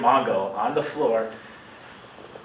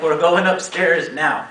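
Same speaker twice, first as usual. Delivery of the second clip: louder, slow, insisting on every word; firm insistence; 4 semitones higher, 6 dB louder, slower than usual. Retold or imitated. Roommate insisting the sick friend come upstairs, louder and slow. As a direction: lean on it like an order you won't repeat. Roommate repeats the order firmly.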